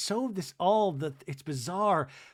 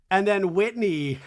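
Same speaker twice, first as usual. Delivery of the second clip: ordinary delivery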